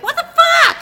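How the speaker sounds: high, loud shocked yell; startled shout. Retold or imitated. Parents see the baby move, shout in shock. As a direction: yell it out in sudden alarm. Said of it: "and they're like".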